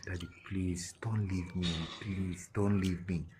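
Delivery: soft retold child's plea; pleading little daughter. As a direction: quietly imitate a small girl begging her father. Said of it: "And she was like".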